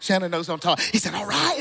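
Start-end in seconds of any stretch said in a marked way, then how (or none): 1.1-1.6 high imitation of the elder; strong-voiced old elder. Preacher showing how the elder talked, high-pitched mimicry. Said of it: "That's how he talked".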